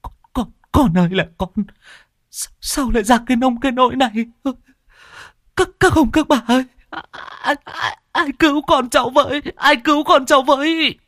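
a mother keening over her drowned son; anguished wail. Acted. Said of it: "chị rú lên đau đớn".

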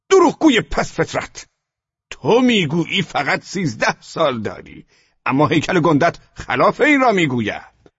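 high, loud enraged officer; furious insult. A narrator's reading. SS officer insulting the boy after slapping him, furious. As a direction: spit the insult after a slap.

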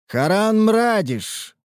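ringing authoritative shout, drawn out; booming commanding call. Acted. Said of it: "зычный властный голос".

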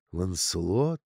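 slightly low, soft questioning sigh; understated wistful query. A narrator's reading. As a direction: say it with a gentle sigh. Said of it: "Она вздохнула".

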